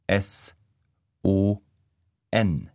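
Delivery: ordinary delivery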